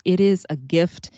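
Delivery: usual speaking voice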